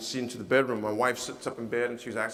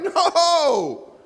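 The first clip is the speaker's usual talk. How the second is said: laughing refusal; 10 dB louder than usual. Retold or imitated. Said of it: "It's like".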